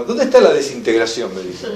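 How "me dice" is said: ordinary delivery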